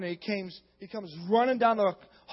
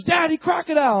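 higher-pitched mock cry; imitated child's alarm; 6 semitones higher, 6 dB louder than usual. Preacher re-enacts son alerting Daddy, mock alarm. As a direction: call out like an alarmed kid.

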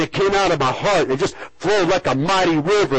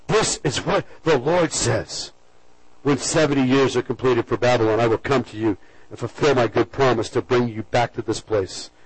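scripture reading; 6 semitones lower than usual. Shown as a demonstration. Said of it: "verse number ten".

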